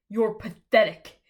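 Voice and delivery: loud, contemptuous put-down; scornful scold. Acted. Alpha scolding the kneeling omega, loud and scornful.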